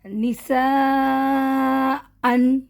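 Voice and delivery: نساءً drawn very slowly with the tanween; Quran recitation demo. Shown as a demonstration. Teacher giving a second example to students, slowly. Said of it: "دوسرا example دو زبر کا".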